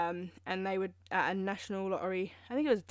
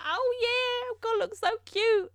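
much higher, airy mock-innocent voice; mock naive confidence; 13 semitones higher than usual. Mocking her teen self in the outfit, high mock-naive voice. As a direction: play your younger self, smug and clueless. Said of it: "I was like".

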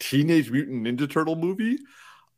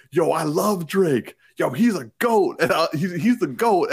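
higher, louder imitation of a hyped teen; excited fanboy gushing; 5 semitones higher, 4 dB louder than usual. Imitating a turtle raving about his favorite rapper, excited. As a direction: gush like a hyped-up fan.

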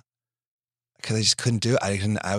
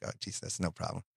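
whispered dread; 3 semitones lower, 13 dB quieter than usual. Retold or imitated. Retold dread of next year.

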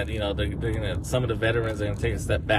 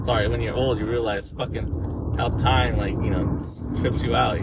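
normal talking voice

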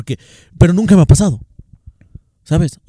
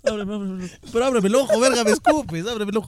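higher, softer voice, pleading with a stinging eye; real discomfort, higher-pitched and pleading; pained pleading; 6 semitones higher, 5 dB quieter than usual. Real, not acted. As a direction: whine for help with something in your eye. Something got in his eye.

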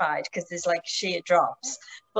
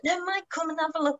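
enthusiastic insisting; 9 semitones higher than usual. Retold or imitated. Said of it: "Martin was being really enthusiastic".

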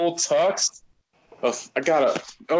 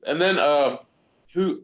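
usual speaking voice

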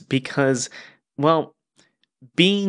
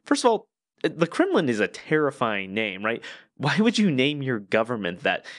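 ordinary talk, nothing marked

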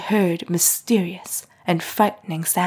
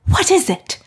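much higher and louder, panicked; panicked cry; 12 semitones higher, 5 dB louder than usual. A narrator's reading. Mrs Lennox hearing the servants wail, panicking. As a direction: shriek the question in rising panic. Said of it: "Missus Lennox gasped".

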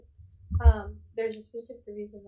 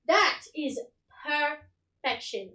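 grand queen voice; 5 semitones higher, 8 dB louder, much slower than usual. Acted. Queen admires his clothes.

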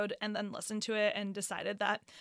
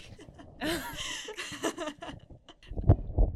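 breathy surprised laugh; 8 semitones higher than usual. Real, not acted. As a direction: laugh out in shock. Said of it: "Oh, shit, my mic".

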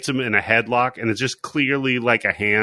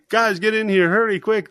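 urgent call; 9 semitones higher than usual. Retold or imitated. Calling friends to see turkey.

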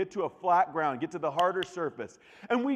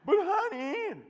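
much higher, pleading "but honey"; whiny plea; slower than usual. Retold or imitated. Pastor voicing his wife pleading with him, high whiny plea. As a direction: whine like a pouting wife. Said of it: "wife pleading".

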